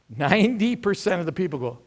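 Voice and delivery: normal talking voice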